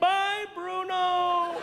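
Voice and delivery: drawn-out high shouted goodbye; mock farewell shout. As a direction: call it out slow and high. Waving off the falcon man.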